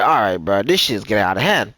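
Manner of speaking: very low-pitched weary reaction; retold fed-up remark. Man recounting partner pushing him, fed up. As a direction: drop deep for a mock-grim verdict.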